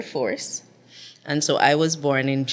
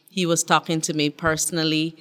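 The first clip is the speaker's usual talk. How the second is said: ordinary talk, nothing marked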